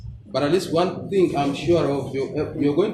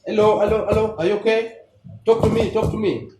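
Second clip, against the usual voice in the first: raised, higher calls of hello to a collapsed patient; calling out to patient; 6 semitones higher, 3 dB louder than usual. Shown as a demonstration. Rescuer calling to a collapsed patient, raised and higher. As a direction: call out loudly, checking for a response. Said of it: "Tap on the shoulder".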